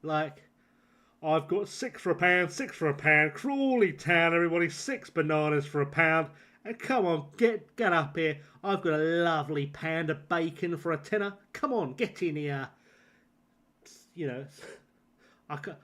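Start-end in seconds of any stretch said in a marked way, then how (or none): 1.2-12.7 loud market trader calling out his deals; market stall trader. Pretend. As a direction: bellow the bargains to the crowd. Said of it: "you sound a bit like you're in a market stall".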